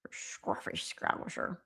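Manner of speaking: low, slow, slurred mock Scouse; hushed, drawn-out attempt at Scouse. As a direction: mumble it softly in a put-on Scouse accent. Playful attempt at a Scouse phrase.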